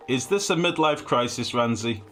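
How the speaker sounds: high, loud, playful grilling; mock interrogation. Streamer teasing a viewer about a midlife crisis, mock interrogation.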